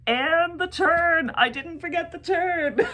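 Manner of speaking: high, loud, drawn-out crow of victory; triumphant. Real, not acted. Proudly announcing the remembered sock turn.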